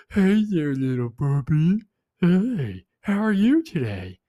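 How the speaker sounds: put-on high, loud baby talk to a puppy; dog voice. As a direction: coo at the dog like a doting stranger. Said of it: "that dog voice".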